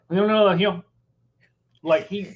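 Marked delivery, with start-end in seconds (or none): 0.1-0.8 garbled Southern mumble, pitched up; Memphis Cajun Southern accent. Retold or imitated. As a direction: run the words together in thick Memphis Southern. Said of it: "But he literally said".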